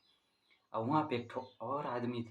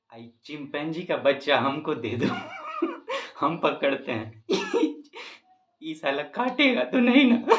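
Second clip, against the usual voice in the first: high, loud words broken by laughter; laughing through the punchline; 5 semitones higher, 9 dB louder than usual. Real, not acted. Comedian delivering the punchline while laughing uncontrollably. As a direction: force the line out while cracking up. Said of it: "थोड़ा break हो गया था".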